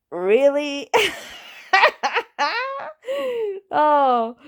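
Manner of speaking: high disbelieving cackle; incredulous laugh. Real, not acted. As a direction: scoff and burst out laughing. Mocking a tea-empire billionaire.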